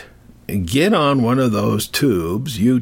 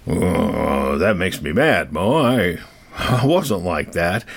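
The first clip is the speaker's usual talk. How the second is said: low offended jock; 6 semitones lower, slower than usual. Retold or imitated. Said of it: "jocks listening to this who are saying".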